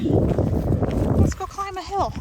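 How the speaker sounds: high, slow coaxing to the dog; drawn-out cheerful invite; excited. Real, not acted.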